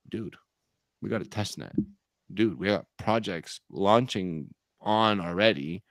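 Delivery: pressure building up. Acted. Early coin buyer listing what the project has, pressure building. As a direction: start held back, then let the pressure build. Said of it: "that pressure's building up too".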